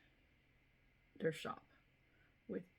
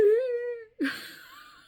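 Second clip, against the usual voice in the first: laugh-cry high and loud; 10 dB louder than usual. Real, not acted. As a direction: laugh that breaks into a high wail. Laughing while recalling sobbing.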